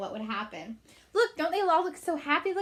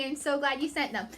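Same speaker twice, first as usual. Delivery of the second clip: ordinary delivery, nothing marked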